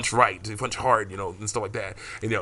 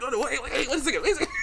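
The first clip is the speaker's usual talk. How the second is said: much higher, giggling through the pleas; laughing protest; much faster than usual. Pretend. Teller imagines being grabbed, protesting high while laughing. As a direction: beg them to stop while cracking up.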